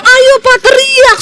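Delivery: loud, high pleading command; wife begging her dying husband to shout; urgent high plea. Retold or imitated. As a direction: push him to cry out.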